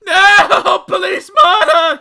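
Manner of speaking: drawn-out wailing scream for help. A narrator's reading. Mister Jelly hit by a falling leaf, screaming. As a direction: scream blue murder, high and loud. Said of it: "he screamed".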